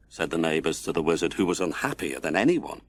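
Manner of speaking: ordinary delivery, nothing marked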